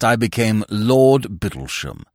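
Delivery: low and slow, "Lord" weighted with a falling close; pompous lord, proud title. Acted. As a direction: announce "Lord Bittlesham" gravely, "Lord" leaned on and dropping. Peer announces his title.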